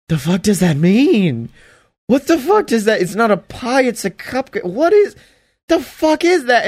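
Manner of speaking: rising from puzzled to high incredulous outburst; baffled disbelief. Retold or imitated. The speaker, stunned by the cupcake line, in rising disbelief.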